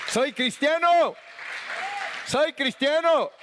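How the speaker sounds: high, stretched celebratory cry; exultant proclamation. Real, not acted. Preacher leads congregation in applause, exultant high cry.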